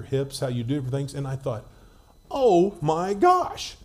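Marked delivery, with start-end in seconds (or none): 2.3-3.8 slow, drawn-out amazed gasp of words. Retold or imitated. Boy watching teammate hit, slow awe. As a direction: stretch it out in awe.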